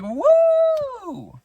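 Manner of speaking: high whooping yell, playful; excited whoop. Retold or imitated. Kid kicking at a bully, gleeful high whoop. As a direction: let out a giddy high whoop.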